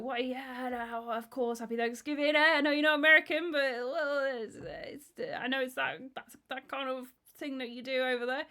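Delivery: high, loud, stumbling mock cheer; flustered greeting. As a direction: babble brightly to cover an awkward moment. Acting out his flustered cheery greeting.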